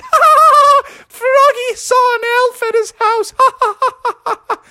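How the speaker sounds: put-on very high, jeering kids' laughing; mocking laughter. Classmates laugh at Froggy's elf story, mocking. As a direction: cackle through a playground taunt.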